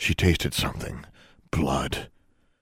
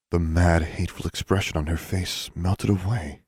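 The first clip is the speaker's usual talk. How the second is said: plain reading voice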